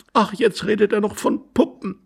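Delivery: high, put-on female groan; despairing aside about her husband; exasperated young wife.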